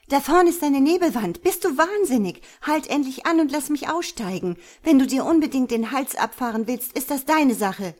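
high, loud, frantic protest; alarmed yelling, pitched well up; calling out. A narrator's reading. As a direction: shout at a reckless driver to stop. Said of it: "rief sie".

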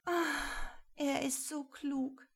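high, drawn-out lovestruck sigh; adoring sigh. A narrator's reading. Rosemarie swoons over how clever he is, sighing. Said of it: "Rosemarie seufzte".

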